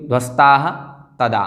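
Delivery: ध्वस्ताः rising to "ha", pause, then तदा; visarga sounded. Shown as a demonstration. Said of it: "विसर्ग आ जाएगा".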